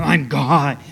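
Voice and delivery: overdone movie God with a hard edge. Retold or imitated. Preacher mimics the Hollywood version of God, hard-edged and booming. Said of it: "spoken with a hard edge to them".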